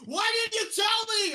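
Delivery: loud, very high shout; imitated frantic mom; mom's shocked yell. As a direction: demand it in a high holler. Mom shouting in retold story.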